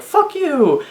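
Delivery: high, shouted curse; mock defiant yell. Susie cursing the knight in a fight, high yell. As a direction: fling it at the enemy. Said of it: "being like".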